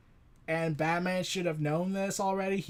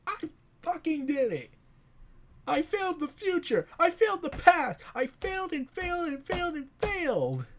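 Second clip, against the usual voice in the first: higher, hammed-up sobbing rant of a broken hero; desperate crying outburst; 7 semitones higher than usual. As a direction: wail out that he's failed everything, near tears. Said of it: "resigns to his fate and screams out".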